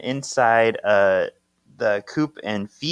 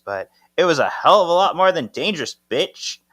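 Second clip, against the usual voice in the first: fast, higher, louder mock snap; mock angry retort; 7 semitones higher, 5 dB louder, faster than usual. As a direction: fire it back at the wife. Acted quarrel, husband snaps back.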